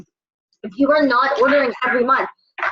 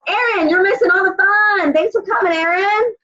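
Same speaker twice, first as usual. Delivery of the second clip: teasing; 6 semitones higher than usual. Real, not acted. Teasing a latecomer.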